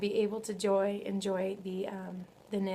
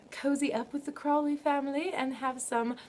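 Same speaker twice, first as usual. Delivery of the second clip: higher-pitched, badly faked English accent; 5 semitones higher than usual. Vlogger jokes about cozying up with Downton family, bad English accent. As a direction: put on a hammy, amateur English accent. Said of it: "That was my worst English accent".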